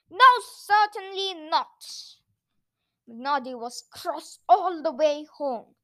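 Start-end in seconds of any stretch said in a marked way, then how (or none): 0.1-1.9 loud, higher firm refusal; indignant refusal. A narrator's reading. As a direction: refuse crossly, outright. Noddy indignantly refusing the swap.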